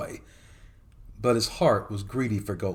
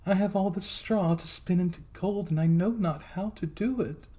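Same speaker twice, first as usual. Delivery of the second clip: higher, tearful-sounding maiden; a young girl's worried voice, pitched higher; maiden's voice; 9 semitones higher than usual. A narrator's reading. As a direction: plead it like a frightened girl. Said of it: "she replied".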